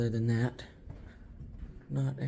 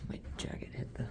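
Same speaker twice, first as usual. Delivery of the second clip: whispering; 10 dB quieter, faster than usual. Real, not acted. Quiet exploring whisper.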